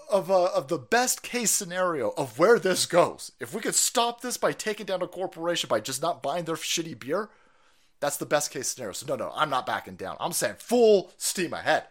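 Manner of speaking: ordinary talk, nothing marked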